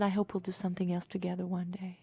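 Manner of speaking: hushed and breathy, unhurried. Retold or imitated. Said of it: "very breathy voice".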